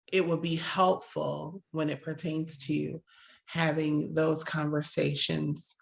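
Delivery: ordinary talk, nothing marked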